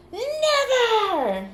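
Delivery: high, drawn-out mock cry; theatrical refusal. Reader refusing to stop pulling cards, theatrical high cry. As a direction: wail it high, then drop.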